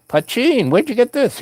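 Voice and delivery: high, amazed disbelief. Retold or imitated. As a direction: squeal it like you can't believe your eyes. Amazed at homemade poutine.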